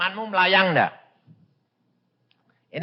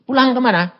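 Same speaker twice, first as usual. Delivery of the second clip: higher and louder, urgent; urgent question; 9 semitones higher, 8 dB louder, faster than usual. Retold or imitated. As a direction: ask sharply in alarm. Asking dying child. (bertanya keras dan mendesak)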